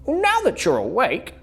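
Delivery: high bright sheep; chirpy sheep invites bear; cheerful sheep voice. A narrator's reading. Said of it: "said Sheep".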